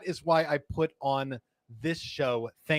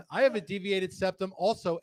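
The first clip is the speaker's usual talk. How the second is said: laughing through the line; can barely get it out laughing; 3 semitones higher, 4 dB quieter than usual. Real, not acted. Reads comment laughing.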